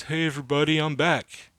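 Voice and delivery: a hint of Giannis, bright and upbeat; attempted Giannis voice. Reading Giannis's tweet to viewers, mild mock Giannis voice. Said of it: "I tried to talk like Giannis".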